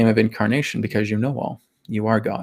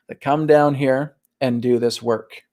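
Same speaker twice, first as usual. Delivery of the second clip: ordinary talk, nothing marked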